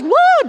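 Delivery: high, loud flailing yelp; mock drowning yelp. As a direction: squeal like a kid going under. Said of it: "she's like ... drowning".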